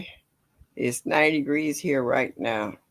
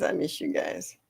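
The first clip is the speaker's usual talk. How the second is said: soft, lower whispered repeat; 10 semitones lower, 6 dB quieter than usual. Real, not acted. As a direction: breathe it out tenderly. Says it twice, second whispered.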